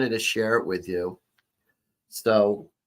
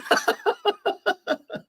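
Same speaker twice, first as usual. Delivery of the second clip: squealing laugh after the punchline; high-pitched burst of laughter. Real, not acted. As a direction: laugh hard and high at your own joke. Laughs at her own punchline.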